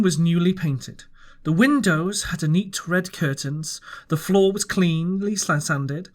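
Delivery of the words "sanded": ordinary delivery, nothing marked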